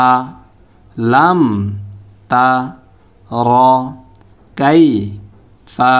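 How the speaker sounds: each syllable alone, with a pause after; measured Quran drill, one syllable at a time; broken syllable-by-syllable reading. Shown as a demonstration. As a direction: break the verse into separate syllables. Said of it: "এখন আমরা ভাঙা ভাঙা উচ্চারণ করব".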